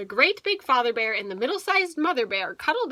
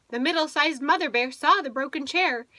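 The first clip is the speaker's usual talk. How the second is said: ordinary delivery, nothing marked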